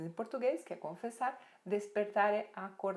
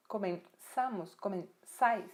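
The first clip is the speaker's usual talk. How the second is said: "Comenzamos, comenzais" split before a stressed SA and SAIS; stressed syllable shown; 3 dB louder, slower than usual. Shown as a demonstration. Teacher points out the underlined syllable, "Comenzamos, comenzais" broken at the stress. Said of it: "Você tá vendo que a sílaba tônica".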